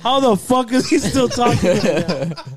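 almost sing it through laughter; laughing outrage. Retold or imitated. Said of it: "He's like".

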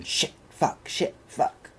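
little voices whispering swear words; kids swearing. Retold or imitated. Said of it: "in the background going".

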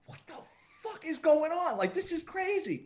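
high-pitched retold astonishment; hammed-up disbelief; bewildered disbelief. Retold bewildered reaction in the car.

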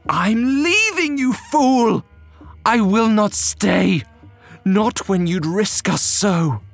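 slow, drawn-out cry, pitched far up; high scream. A narrator's reading. Wife leaving her husband, screaming high. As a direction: scream it at him, stretching the words, then easing down. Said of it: "She screamed at him".

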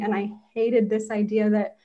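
usual speaking voice

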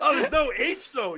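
high, loud, laughing mimicry; helpless laughter while mocking; mocking through laughter. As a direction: throw the objection back, cracking up. Mocking co-host's objection while laughing.

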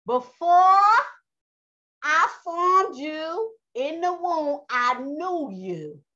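very slow, drawn-out divine proclamation; Yah speaking. Acted. Preacher repeating Yah's words for Israel, slowly proclaimed. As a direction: ring out "Before" high and loud, then stretch each phrase. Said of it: "This is what Yah said".

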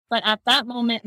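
ordinary talk, nothing marked

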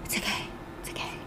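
fast, hushed whisper; Teddy soothing Rachel as he pats her hair. Retold or imitated. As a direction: hush her quickly in a whisper. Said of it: "he's like".

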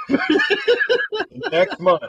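high, slow words through laughter; real laughter breaking up the words; laughing. Real, not acted. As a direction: crack up while getting the phrase out. Cracks up while telling story.

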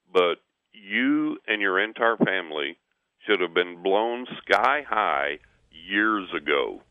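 slow, weighty retold warning; grave verdict. Gas men tell homeowner of his narrow escape, grave verdict. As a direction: spell it out slowly, stretching key words.

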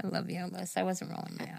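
hushed sheepish denial; whispered. Real, not acted. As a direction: mutter it under your breath. Quiet denial to daughter-in-law.

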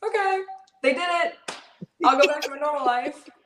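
high-pitched little voice for her past thoughts; quoting own reaction. Retold or imitated. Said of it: "I was like".

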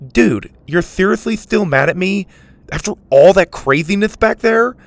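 can't believe he's still sore; incredulous. A narrator's reading. Mac to sulking Dylan, loud and incredulous. Mac scolds angry friend.

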